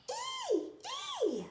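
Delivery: very high squealing pig. Acted. Animals cheer in the story.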